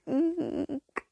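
thin, high-pitched eek; excited little squeal. A narrator's reading. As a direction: squeak it out high, without volume. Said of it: "she squealed".

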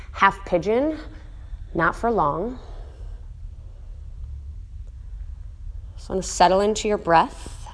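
soft, unhurried cool-down guidance; calm yoga voice. Acted. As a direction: ease the class down gently, softly.

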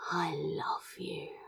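hushed whisper, breath held. Acted. Crow finally confesses to Azra in a trembling whisper. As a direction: whisper it like stepping off a cliff. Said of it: "finally whispered the words".

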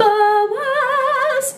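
high, drawn-out, held cry of the name; excited call-out. Real, not acted. Co-host shouting the answer to who married a Moabite, gleeful. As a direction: belt the name out and hold it.

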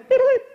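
very high, loud beeps; computer beep imitation. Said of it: "my Mac is going".

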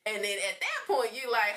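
ordinary delivery

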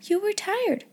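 put-on high, gentle girlish voice; high, comforting tone; friendly. A narrator's reading. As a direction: excuse her kindly. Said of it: "such a nice friendly sound".

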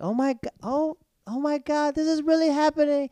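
high, loud squeal of disbelief; mock excited onlooker. Coworkers watching a friend's mock proposal, hammed-up excitement.

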